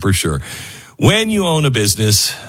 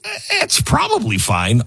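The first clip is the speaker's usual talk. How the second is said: higher, slow dismissive shrug; careless shrug; 10 semitones higher than usual. Acted. Ad read mocking a careless business owner, high and dismissive. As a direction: brush it off lazily. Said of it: "don't go with".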